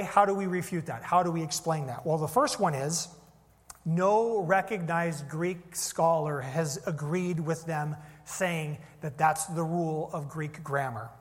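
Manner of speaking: normal talking voice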